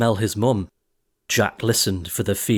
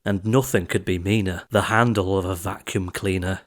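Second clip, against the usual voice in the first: usual speaking voice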